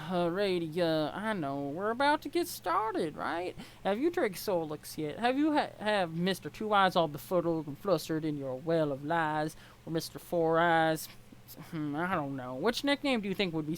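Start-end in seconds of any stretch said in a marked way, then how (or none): 0.1-11.1 lightly voiced Southern accent, teasing. Troll girl teasing Ebony about Solix in a light Southern accent. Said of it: "this is Southern accent".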